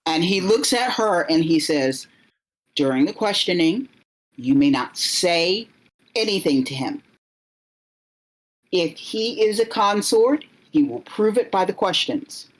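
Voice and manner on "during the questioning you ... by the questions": measured, slightly raised questioning voice; formal interrogator. Acted. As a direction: lay down the rules like a stern official.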